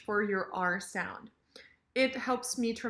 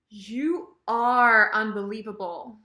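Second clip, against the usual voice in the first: "are" drawn out and louder; emphatic "are"; 6 dB louder than usual. Shown as a demonstration. Teacher agrees, emphasizing the verb, stressed "are". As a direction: push hard on "are" before "unbelievable". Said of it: "I'm pushing that. I'm emphasi-, emphasizing".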